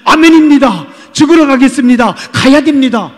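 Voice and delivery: loud, high, wholehearted vow; fervent amen. Retold or imitated. Preacher models a believer's answer to the Lord as a loud, fervent vow. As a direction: shout your yes to the Lord. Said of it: "주님이 말씀하시면 그렇게 얘기하는 겁니다".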